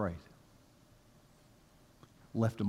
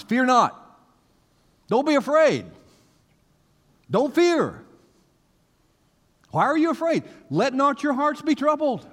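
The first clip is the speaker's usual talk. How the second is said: loud, high-pitched preaching of Jesus' words; preaching at full force; 11 semitones higher, 6 dB louder than usual. Acted. Preacher booming Jesus' words to the congregation, high and loud. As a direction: proclaim it to the whole church at full volume.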